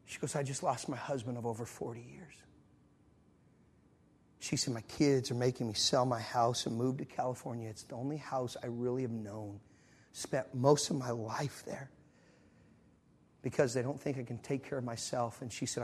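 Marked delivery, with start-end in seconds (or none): 0.4-2.3 low, hushed confession; subdued grief. Retold or imitated. Widow confiding in traveler, subdued grief. 4.7-15.4 low, hushed confession; subdued grief. Retold or imitated. Widow confiding in traveler, subdued grief.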